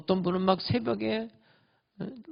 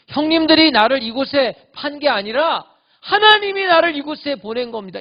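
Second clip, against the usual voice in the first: higher, louder declaration; ringing proclamation to the brothers; proclaiming; 8 semitones higher, 10 dB louder than usual. Pretend. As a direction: announce it triumphantly.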